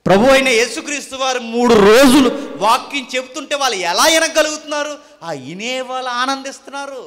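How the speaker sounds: high, loud sermon delivery; forceful preaching. Real, not acted. Preacher telling congregation how crowds delighted in the word, forceful preaching.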